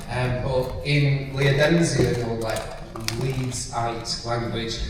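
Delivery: normal talking voice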